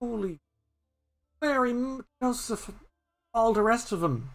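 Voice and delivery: put-on high child gasping in fear; frightened Patter. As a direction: whisper in awe then ask. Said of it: "Patter kinda leans".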